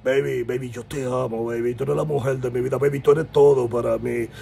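macho voice. Retold or imitated. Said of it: "él así, con esa voz".

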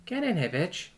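a playful order, not shouted; affectionate order. A narrator's reading. Terran beckoning her lover into bed, ordering. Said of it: "the Terran orders".

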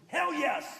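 sudden high, loud retort; very loud answer. Retold or imitated. Dad answers son about regretting the divorce, booming loudly. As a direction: burst out with an emphatic shout. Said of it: "he answered very loudly".

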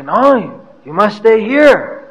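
retold host's insistence, pitch swooping up; insistent welcome. Host urging visiting preachers to stay, insistent.

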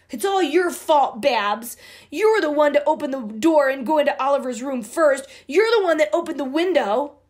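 put-on loud, fast accusing girl voice. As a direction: blame your sister hotly. Sisters quarrel over blame.

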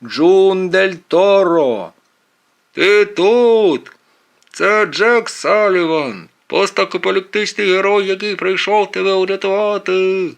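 loud, high megaphone-style call; shouting. A narrator's reading. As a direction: call out slowly to someone far away. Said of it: "А я кричу".

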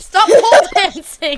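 loud, high laughing plea. Real, not acted. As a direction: crack up mid-sentence. Laughing at friend.

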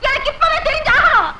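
high, insistent outburst; agitated demand. Acted. Suspicious wife demands truth about husband, high agitated outburst.

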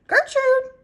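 high, loud parrot squawk; irritating parrot scream. A narrator's reading. Parrot screaming in the pet store, irritating screech. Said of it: "screams in a real irritating voice".

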